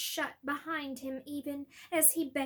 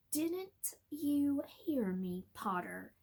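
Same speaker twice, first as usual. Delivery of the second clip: taunting Snape; slower than usual. Acted. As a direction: taunt him slowly.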